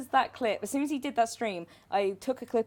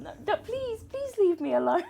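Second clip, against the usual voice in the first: mock pleading; 10 semitones higher, 4 dB louder than usual. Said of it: "Just pleading with them".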